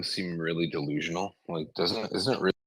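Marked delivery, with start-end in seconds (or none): 2.2-2.5 choppy, dropping-out audio; bad connection. Real, not acted. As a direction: voice breaking up on a bad line. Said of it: "your voice is cutting out really bad".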